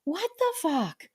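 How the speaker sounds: high, drawn-out squeaky bird; very high cartoon bird voice; little bird voice. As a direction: stretch it out as a baffled little bird. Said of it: "it's going".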